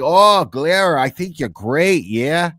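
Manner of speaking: lightly imitating an eager caller; Canadian caller voice. Host mimicking a Canadian caller praising him, mildly. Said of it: "they're like".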